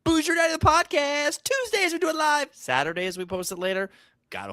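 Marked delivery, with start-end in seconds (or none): 0.0-2.5 high, hammy radio announcer. Acted. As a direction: sell the show title like a DJ. High announcer-style show title.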